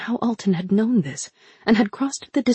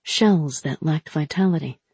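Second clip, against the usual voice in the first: usual speaking voice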